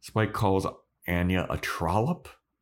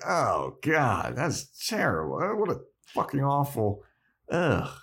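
groaning mock disgust, higher toward the end; 5 semitones higher than usual. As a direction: act out being appalled by an awful name. Spike acted reacting to his name.

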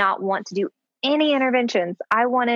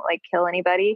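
normal talking voice

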